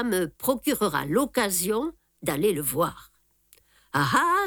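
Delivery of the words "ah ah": exclaiming. A narrator's reading. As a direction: pounce on it with glee. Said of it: "s'écria Japp".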